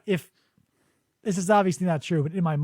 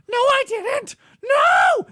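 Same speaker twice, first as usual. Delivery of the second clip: high, loud whiny denial; high bratty shout; petulant child; 5 dB louder, much slower than usual. Retold or imitated. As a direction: deny it like a caught kid. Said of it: "he acts like a petulant child".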